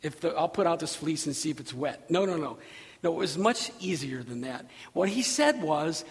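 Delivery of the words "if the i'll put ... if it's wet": low, offhand mock quote; half-hearted and softer, dropping lower; dismissed casual suggestion. Preacher voicing what was not said, offhand. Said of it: "It wasn't like".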